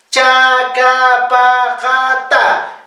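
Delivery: "Chacapajata" as five drawn-out syllables with plain unaspirated stops; consonantes simples. Shown as a demonstration. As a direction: say "Chacapajata" slowly, no puff or pop. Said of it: "Consonantes simples". (consonantes simples, sin aspirar)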